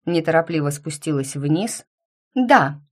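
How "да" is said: loud, higher-pitched yes; raised voice, emphatic. A narrator's reading. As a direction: say it loudly, pointedly. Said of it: "говорила громко".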